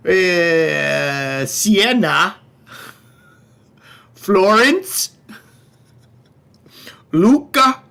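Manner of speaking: hammy Upper Midwest Wisconsin twang; Wisconsin accent. Pretend. Viewer request for Italian towns, hammed-up Wisconsin accent. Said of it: "with a Wisconsin accent".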